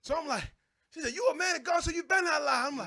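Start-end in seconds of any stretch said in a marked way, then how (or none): 1.1-2.6 higher, louder threatening warning; mock stern warning. Wife warning husband not to lie, loud stern voice. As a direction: warn him sharply.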